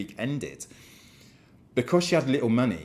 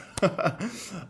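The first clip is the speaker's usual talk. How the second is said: light laugh; 9 semitones higher than usual. Real, not acted. Laugh after apologising to viewers.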